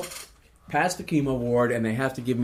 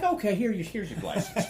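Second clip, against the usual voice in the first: higher, lightly acted clerk voice; flustered clerk; 9 semitones higher than usual. Clerk rushing out a license on hearing cancer, higher mimicked voice. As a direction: lightly mimic a clerk hurrying to hand it over. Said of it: "She's like".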